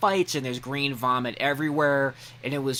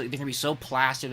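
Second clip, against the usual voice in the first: ordinary talk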